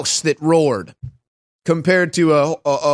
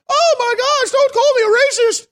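very high, squeaky mock panic; mock cowering fear. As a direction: whimper it like a frightened caricature. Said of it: "Americans cower in fear, like".

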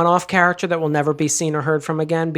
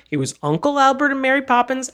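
normal talking voice

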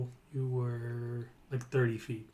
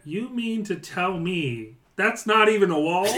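higher, louder goblin disbelief with a laugh; exasperated goblin boss; 7 semitones higher, 5 dB louder than usual. Acted. Goblin boss to his fallen lieutenant, high and laughing. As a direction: scold the lieutenant like an incredulous goblin. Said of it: "the goblin boss looks at the goblin lieutenant".

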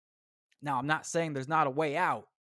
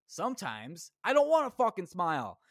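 normal talking voice

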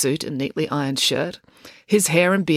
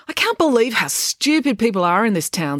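higher, exasperated complaint; exasperated disbelief; 6 semitones higher than usual. Acted. April grumbling to her family about the town, exasperated.

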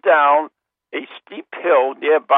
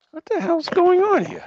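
breaking up; 14 semitones higher than usual. Real, not acted. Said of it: "breaking up".

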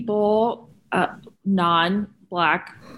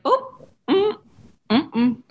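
mock pondering; 8 semitones higher, slower than usual.

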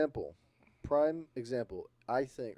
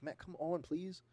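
pleading; 7 semitones higher, 6 dB quieter, slower than usual. Acted. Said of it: "She's like".

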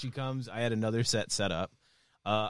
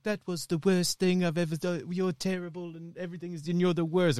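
imitating Simon Cowell; 6 semitones higher than usual. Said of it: "So Simon Cowell's like".

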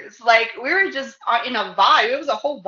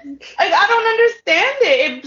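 exasperated; 8 semitones higher, 3 dB louder than usual. Real, not acted. Outrage at double standard.

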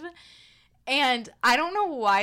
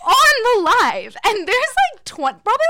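loud laughing; 13 semitones higher, 11 dB louder than usual. Real, not acted. Laughing disbelief at the fart story.

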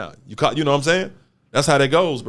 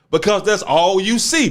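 ordinary talk, nothing marked

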